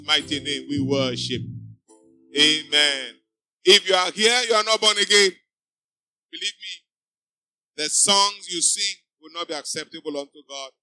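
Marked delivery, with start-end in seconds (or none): none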